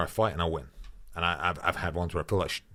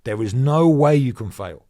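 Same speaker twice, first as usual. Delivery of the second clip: emphatic; 6 semitones higher, 9 dB louder than usual. Real, not acted. Insistent advice on grinding.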